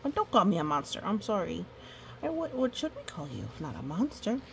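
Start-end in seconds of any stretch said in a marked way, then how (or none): none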